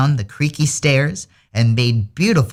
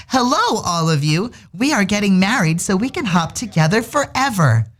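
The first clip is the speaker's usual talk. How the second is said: happy announcement; 8 semitones higher than usual. A narrator's reading. Bunnies announce wedding happily.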